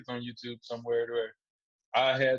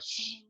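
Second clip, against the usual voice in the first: drawn shushing sound; a long "shh" to hush the crowd; 7 dB quieter than usual. Retold or imitated. Fighter demonstrating how he hushed the crowd, shushing. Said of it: "did this, like".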